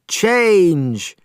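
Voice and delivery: casting a spell on the dragon; magic command. Acted. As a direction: cast the spell with a call. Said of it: "she shouted".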